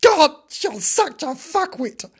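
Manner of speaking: high, slow, hammed-up scorn; exasperated insult. Dog improvising abuse at the kitten, exasperated.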